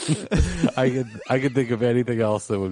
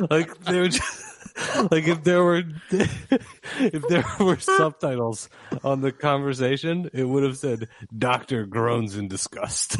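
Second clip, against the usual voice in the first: laughing while talking; 6 semitones higher, slower than usual. Real, not acted. Laughing through the story.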